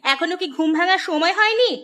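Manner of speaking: nagging morning scolding, rising at the end; scolding wife. Acted. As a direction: berate a husband who is still asleep. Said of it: "তুমি এভাবে চিৎকার করছো কেন?". (ঘুমন্ত স্বামীকে বকুনি)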